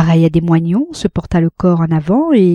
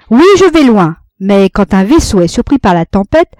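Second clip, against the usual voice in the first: defiant orator; 3 semitones higher, 6 dB louder than usual. A narrator's reading. Orator defends himself loudly.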